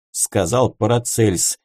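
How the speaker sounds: ordinary delivery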